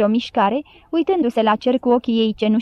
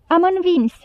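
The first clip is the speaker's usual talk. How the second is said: higher-pitched cry, only mildly raised; narrated victory cry; 6 semitones higher than usual. A narrator's reading. The people cry out their victory, mild high-pitched shout. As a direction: lightly voice a crowd crying out in triumph. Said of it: "striga poporul".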